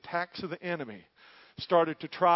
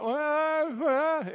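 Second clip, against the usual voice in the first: high wailing sheep cry, much higher and louder; 12 semitones higher, 7 dB louder than usual. Retold or imitated. Preacher voices a sheep caught in briars, wailing high. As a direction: imitate a trapped sheep wailing. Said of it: "be crying out".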